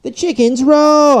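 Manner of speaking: high, slow, loud mock-dramatic line; dramatic trailer line. Vlogger mocking MasterChef adverts, hammed-up dramatic. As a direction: ham up the cooking-show trailer drama. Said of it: "it's always like".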